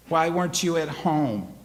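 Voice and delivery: loud, high demand; accusing shout. Retold or imitated. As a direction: hurl the accusation. Boy accusing mother.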